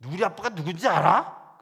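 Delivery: loud taunt with 알아 rising at the end; defiant child's challenge. Retold or imitated. Girl boasting of her principal father, loud and rising. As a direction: dare the teacher to answer. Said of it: "그 애".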